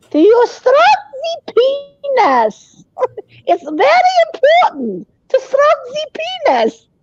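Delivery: put-on high German-accented sex therapist; Doctor Ruth impression. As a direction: lay on the old Dr. Ruth voice. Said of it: "listening to her talk about sex".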